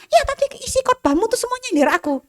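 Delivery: resentful complaint. Retold or imitated. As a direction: complain he was aiming every word at her. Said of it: "dia bilang gitu". (mengeluh dengan kesal, suara jauh lebih tinggi)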